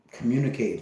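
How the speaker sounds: normal talking voice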